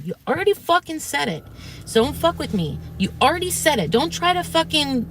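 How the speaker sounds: retold heated telling-off, pitched high; fired up. Caller confronts streamer over his manipulation, fired up. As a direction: re-enact snapping back at him, voice jumping up. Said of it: "You're getting me fired up".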